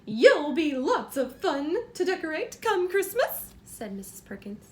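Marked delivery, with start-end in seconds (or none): 0.1-3.5 a louder, delighted cook's voice; cheerful cook. Acted. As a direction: let the cook beam over her idea. Cook's quoted line.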